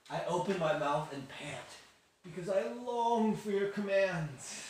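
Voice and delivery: lightly hammed-up psalmist, drawn out at the end; David longing. Youth leader quotes David's funny psalm, mock longing. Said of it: "he writes about God's laws in a really funny way".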